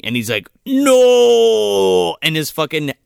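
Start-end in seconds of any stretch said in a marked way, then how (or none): 0.7-2.2 loud, high, long-held mock "no"; exasperated yell. Irishman corrected about Lake Erie, exasperated drawn-out yell. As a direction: hold it out loud in hammed-up frustration. Exasperated retort in acted argument.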